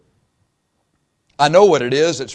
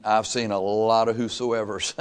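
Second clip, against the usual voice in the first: normal talking voice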